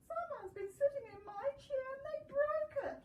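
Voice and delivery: high, whiny little bear voice; Baby Bear. Acted. Baby bear finds his chair broken, high and upset.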